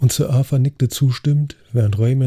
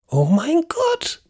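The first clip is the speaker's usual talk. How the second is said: very high, drawn-out terrified cry; shrill shriek of horror; slower than usual. Acted. Lady Agatha seeing the voodoo doll, shrill horrified shriek. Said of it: "stieß einen schrillen Schreckensschrei aus".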